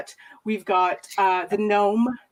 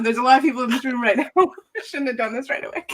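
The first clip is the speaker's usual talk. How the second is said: ordinary talk, nothing marked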